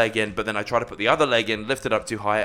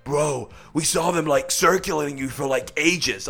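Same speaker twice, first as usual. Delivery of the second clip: put-on American bro voice, a little raised; American accent; 5 semitones higher than usual. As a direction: imitate an American dude calling out. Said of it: "this American guy comes out of nowhere and goes".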